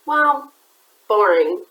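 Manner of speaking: ordinary delivery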